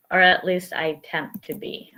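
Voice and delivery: usual speaking voice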